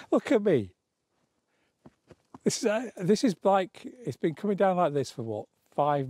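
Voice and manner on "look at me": higher, amused look at me. Real, not acted. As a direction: show off the snow with a laugh in the voice. Showing herself covered in snow.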